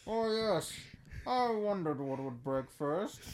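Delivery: slow, menacing villain; lightly voiced menacing villain. Voicing Bane as villain.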